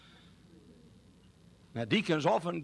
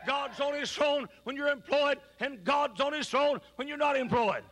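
rising, shouted sermon cadence; fiery preaching; 8 semitones higher than usual. Acted. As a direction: preach it with mounting fire. Preaching at full force.

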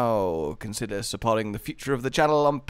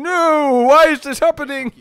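high, louder mock wail; 12 semitones higher, 10 dB louder than usual. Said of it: "while saying".

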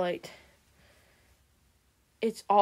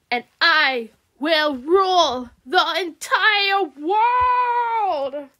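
louder, higher scheming villain; gloating villain queen; 5 semitones higher, 5 dB louder than usual. Acted. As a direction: gloat over her plan like a wicked queen. Villain Medusa plots aloud.

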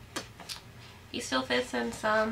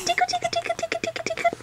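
very high playful tickle chant; giggly tickle attack; much faster than usual. Real, not acted. Tickling baby.

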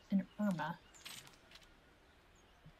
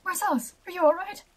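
high, loud startled question; acted worried cry, pitched high; alarmed concern; 8 semitones higher, 4 dB louder than usual. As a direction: call out in sudden alarm. Alarmed reaction to the startled yell.

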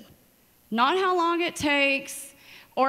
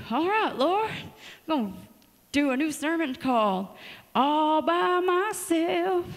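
mock sermon title; 4 semitones higher than usual. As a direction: jokingly croon the title like a pity song. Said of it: "Just kidding".

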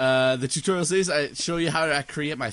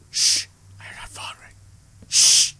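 fast whisper; 6 dB louder than usual. Pretend. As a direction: hush and whisper it. Listening for a mob.

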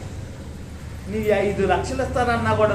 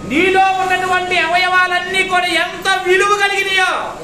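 loud, slow, higher preaching cry; impassioned preaching; 10 semitones higher, 6 dB louder, slower than usual. Real, not acted. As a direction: make them feel the worth. Impassioned sermon climax.

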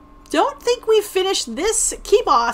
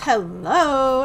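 ordinary delivery

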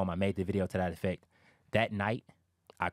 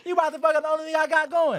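much higher and louder, fast, half laughing; laughing mock rant; 8 dB louder, faster than usual. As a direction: ham up his angry rant while cracking up. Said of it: "the attitude he had".

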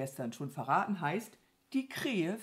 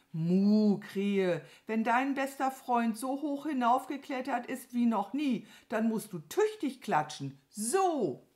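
higher, lively cow voice; eager Mama Mu; 6 semitones higher, 4 dB louder than usual. Acted. (eifrige Kuhstimme)

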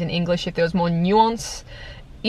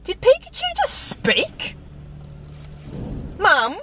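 put-on very high, slow little-kid voice; bewildered child; 14 semitones higher, slower than usual. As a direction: ask it like a confused small child tugging at Mum. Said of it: "every child was like".

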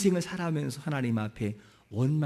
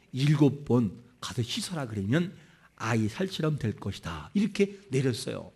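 normal talking voice

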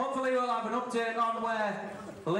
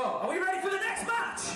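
crowd hype shout; 6 semitones higher than usual. Real, not acted. Announcer hyping the crowd.

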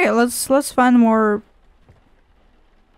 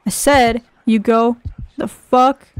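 ordinary talk

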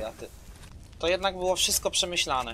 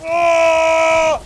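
surprised shout; 11 semitones higher, 12 dB louder than usual. Real, not acted. Sudden surprise in game.

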